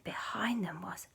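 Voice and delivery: hushed build-up; suspense whisper. A narrator's reading. Narrator building suspense, hushed whisper. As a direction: hold back the reveal in a whisper.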